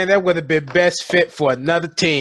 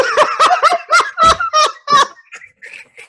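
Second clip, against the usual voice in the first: loud high laughter; 4 dB louder than usual. Real, not acted. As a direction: crack up laughing. Laughs at team names.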